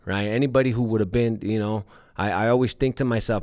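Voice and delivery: usual speaking voice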